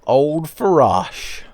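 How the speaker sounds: slow, drawn-out grand naming; dramatic slow greeting. Acted. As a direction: savour the name like an old friend.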